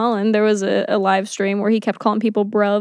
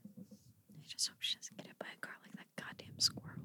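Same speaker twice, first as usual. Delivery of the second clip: hushed, breathy whisper of dread; 28 dB quieter than usual. Real, not acted. Worried for the street cat, whispering to her co-host. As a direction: drop to a whisper as if the thought is too grim aloud. Grim worry about cat, car.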